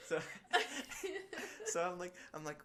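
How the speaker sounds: high laughing through words. Real, not acted. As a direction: laugh while telling it. Laughing at own story.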